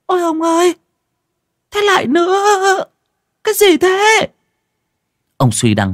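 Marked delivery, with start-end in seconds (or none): 0.1-4.3 high, loud alarmed cry; panicked. Acted. Wife finds her husband by another corpse, crying out high in panic. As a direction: gasp it out in panic. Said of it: "Bà hốt hoảng".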